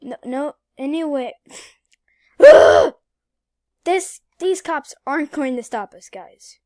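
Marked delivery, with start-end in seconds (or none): 2.4-2.9 cry. Real, not acted. Frustrated outburst in game.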